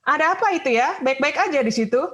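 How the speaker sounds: loud call from the front; driver calling back. Retold or imitated. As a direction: bus driver calls out to the mother, loud. Bus driver asks what's wrong.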